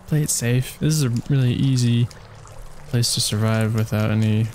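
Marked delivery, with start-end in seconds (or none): none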